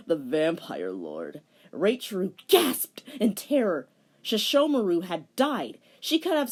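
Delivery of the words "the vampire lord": low slow villain voice; vampire lord. Acted. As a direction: drag it out low like a dark lord. Villain names himself.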